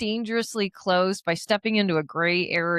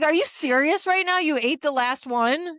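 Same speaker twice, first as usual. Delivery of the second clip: incredulous; 6 semitones higher than usual. Retold or imitated. Retelling her challenge to the mint-eater, incredulous. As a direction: act out indignant disbelief, slowing toward the end. Retold confrontation over the last mint.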